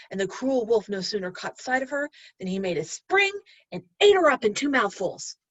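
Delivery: ordinary delivery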